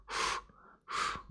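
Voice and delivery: gentle blowing on a sore ear; soft blowing breaths. Acted. Green colour blowing on the rabbit's ear, soft breaths. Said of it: "我给你吹吹".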